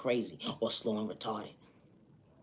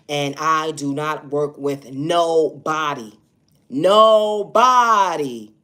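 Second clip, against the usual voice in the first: louder and slower, stretching out nobody; emphatic loud insistence; 4 semitones higher, 11 dB louder, much slower than usual. Real, not acted. Vlogger insisting to viewers she works with nobody, loud and slow.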